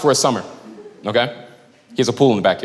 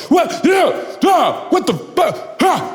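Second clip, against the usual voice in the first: high, frantic sputtering; mock meltdown; 8 semitones higher than usual. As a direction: play a grown man melting down. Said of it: "have a fucking meltdown".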